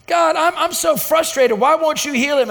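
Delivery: a touch of exasperated prayer; frustrated plea. Retold or imitated. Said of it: "I get mad and I'm frustrated".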